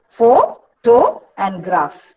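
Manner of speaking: "pho-, to, and graph" in slow separate units; syllable by syllable. Shown as a demonstration. Teacher showing students three units, syllable by syllable. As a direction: break "pho-, to, and graph" apart with pauses. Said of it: "divide this word only into three units".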